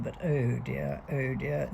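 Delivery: ordinary talk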